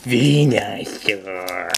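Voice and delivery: slow, dopey cartoon Pokemon voice; derpy Venusaur imitation. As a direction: drag out Venusaur like a goofy cartoon creature. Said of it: "Trying to imitate the one from Hey You Pikachu".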